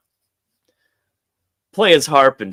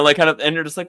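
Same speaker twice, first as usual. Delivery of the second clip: usual speaking voice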